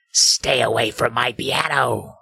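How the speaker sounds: slow, lightly spooky ghost warning; a hint of a ghostly voice. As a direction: intone it like a haunting. Ghost speaks again in story.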